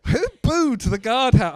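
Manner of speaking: loud shout out to the room; calling out. Real, not acted. Comic shouts to the audience who booed, loud call-out. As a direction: bellow it across the crowd.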